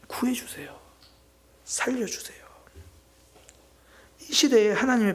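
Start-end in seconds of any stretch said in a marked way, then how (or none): 0.1-2.4 soft, breathy begging to be saved; whispered plea. Acted. A sinner who knows he will not change begging God, whispered. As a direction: plead with almost no voice. Voicing prayer for rescue.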